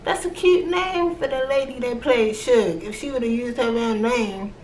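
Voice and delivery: very high, gushing voice. Retold or imitated. Vlogger cooing over the actress's real name, very high gush. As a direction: squeal it out like it's adorable. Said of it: "And I was like".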